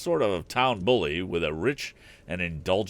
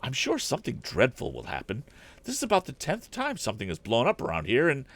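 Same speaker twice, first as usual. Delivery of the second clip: a higher-pitched fussing woman, lightly voiced; a worried housekeeper pleading with the inventor; housekeeper's voice; 7 semitones higher than usual. A narrator's reading. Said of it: "a woman's voice chimed in".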